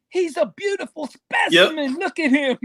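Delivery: a light Australian take on an awed wildlife host; Steve Irwin impression. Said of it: "You remember what he used to say".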